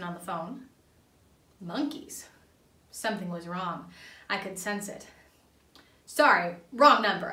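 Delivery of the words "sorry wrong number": sharp, loud snap; loud irritated snap at the caller; snapped. Acted. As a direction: bite the words off, annoyed. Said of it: "I snapped".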